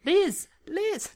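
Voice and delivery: high-pitched name calling; dramatic high call. A narrator's reading. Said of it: "Calling into your ear".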